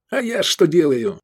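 high, irritated retort; snapping back. A narrator's reading. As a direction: snap back at the colonel. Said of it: "огрызнулся пилот".

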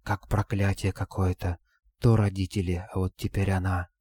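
hushed gossiping whisper. A narrator's reading. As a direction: overheard murmured whisper. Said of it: "обострённый слух услышал шёпот".

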